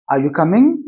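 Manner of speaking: "coming" gliding upward in pitch; rising tone. Shown as a demonstration. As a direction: question tune rising on "coming". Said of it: "rising tone, what we call intonation".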